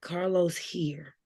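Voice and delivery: slow, breathy whisper. Retold or imitated. Telling a friend about the book scene, slow whisper. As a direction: breathe it out slowly like a secret.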